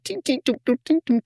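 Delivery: tinkle it out like a delicate score; imitating the score. Shown as a demonstration. Mimicking the film's score for the co-host, light plinks. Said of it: "with a tink".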